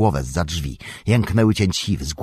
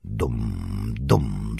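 very low, soft drum booms; thundering drums; 9 semitones lower, 5 dB quieter than usual. A narrator's reading. Narrator voicing drums that shake the chamber, deep thundering booms. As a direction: imitate deep, distant drumbeats. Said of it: "zagrzmiały bębny".

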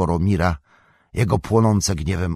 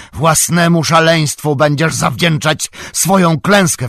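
high, loud furious shout; wściekły wrzask; 10 semitones higher, 8 dB louder than usual. A narrator's reading. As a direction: yell in rage as the warrior. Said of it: "wrzasnął Boromir". (wściekły, głośny krzyk)

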